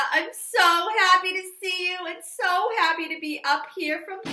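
high, loud sweet lady voice; high and loud Gloria greeting the children. Acted. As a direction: become a chirpy visiting guest. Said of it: "I have a guest speaker".

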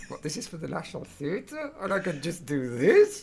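put-on high-pitched incredulous question in Hull accent; broad Hull accent. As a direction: ask it amazed, in a Hull accent. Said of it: "in your broadest Hull accent".

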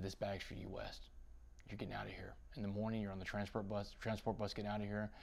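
softly, as if confiding a secret; hushed guard. Retold or imitated. Guard secretly warns of transfer.

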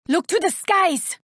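a sharp warning cry upward; high battle call. Acted. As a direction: call it out high and urgent to the team. Battle callout to team.

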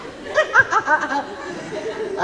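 high, light real laughter. Real, not acted. Comic after the card punchline, real amused laugh. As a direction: laugh freely at your own joke.

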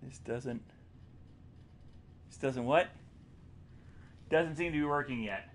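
very soft, near whisper; hushed. Real, not acted. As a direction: keep your voice right down. Hushed while sneaking in game.

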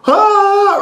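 high, loud mock scream of shock; Home Alone scream. Shocked by the friend's news, a high mock scream. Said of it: "I felt like the guy from Home Alone".

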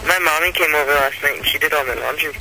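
ordinary talk, nothing marked

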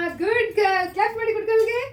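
cheering praise. Real, not acted. Mother applauding her child for drinking, cheering praise.

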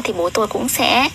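ordinary talk

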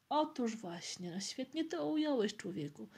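usual speaking voice

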